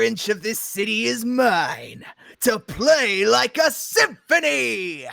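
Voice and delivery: slow, grand, booming gloat; grand villain gloat. Acted. Negaduck gloating over his city, grand and slow. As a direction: savour every word like a conductor.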